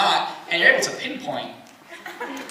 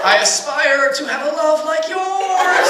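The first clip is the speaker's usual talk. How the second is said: put-on drunk sentimental son; 5 semitones higher, slower than usual.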